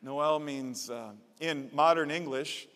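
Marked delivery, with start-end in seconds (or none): none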